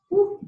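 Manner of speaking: loud high whoop of effort; excited woo. Real, not acted. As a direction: let out a big cheering woo. Cheer during sprint.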